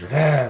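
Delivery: half-hearted roar; mild roar. Retold or imitated. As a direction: mimic his sudden snap. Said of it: "and he's".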